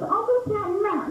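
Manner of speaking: put-on high, slow sing-song kid voice. Kid deciding to tag a crush, high sing-song. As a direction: say it like a coy little kid.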